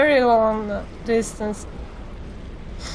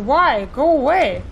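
ordinary talk, nothing marked

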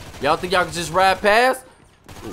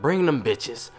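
ordinary talk

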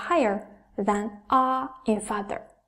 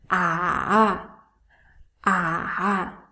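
"Ah" dipped low, then raised; turning third tone; 4 semitones lower than usual. Shown as a demonstration. Teacher demonstrates the third tone, low dipping "ah". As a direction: sink "ah" to the bottom, then turn it up. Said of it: "is a turning tone".

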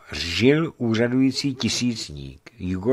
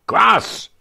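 loud, high bellowed order; thunderous shout; 13 semitones higher, 8 dB louder than usual. A narrator's reading. Waking merchant calling for kvass, thunderous shout. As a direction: thunder it out as a command. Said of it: "zvolal hromovým hlasem".

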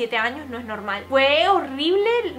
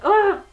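loud, higher "ugh" of disgust; disgusted groan; 6 semitones higher, 6 dB louder than usual. Retold or imitated. Woman sees the same man again, disgusted groan. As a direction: groan in horror at the sight of him.

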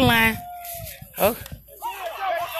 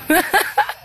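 short rising giggle; laughing; 9 semitones higher than usual. Real, not acted. Vlogger giggles at her own game joke. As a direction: chuckle at the joke.

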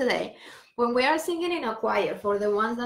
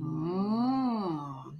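hummed M; 2 semitones lower than usual. Shown as a demonstration. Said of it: "Feel the M on your lips".

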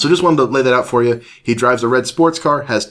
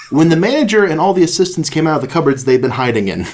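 usual speaking voice

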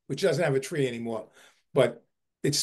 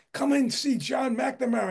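excited invitation; 9 semitones higher than usual. Retold or imitated. Neighbors called to see John.

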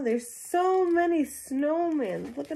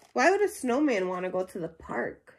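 ordinary delivery, nothing marked

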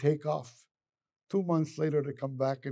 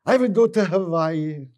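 loud, higher-pitched dreamy announcement; relishing a dream trip; gleeful; 5 semitones higher, 10 dB louder than usual. Acted. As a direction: proclaim it with a gleam in the eye. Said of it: "this gleam in his eye".

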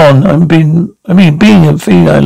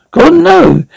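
shocked disbelief; 12 semitones higher than usual. Acted. Shocked reaction to news.

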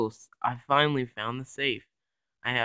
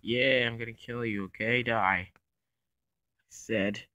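ordinary talk

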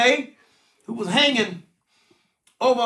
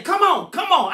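urging God; 8 semitones higher, 5 dB louder than usual. Acted. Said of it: "God saying".

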